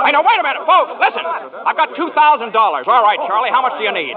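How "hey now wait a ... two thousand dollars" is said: raised voice to crowd. Acted. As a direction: call over the noise to get attention. George quiets the crowd again.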